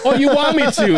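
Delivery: playful singer's voice. Retold or imitated. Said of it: "And then she goes".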